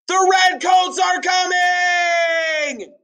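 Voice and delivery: very high, slow warning shout; high loud cry. Acted. Paul warning the town, very high and slow. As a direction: call it out through the whole town.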